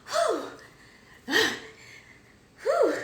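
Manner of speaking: high, shaky whew and sighs, collecting herself; blowing out breath to steady real emotion; emotional exhale. Real, not acted. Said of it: "I didn't think I would be emotional".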